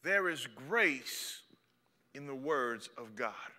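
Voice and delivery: slow tender emphasis. Acted. Slow soft repeat of theme.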